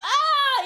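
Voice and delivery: short, high, loud cry; startled scream. Real, not acted. Streamer recoiling from a mystery object, startled scream. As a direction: yelp as you recoil.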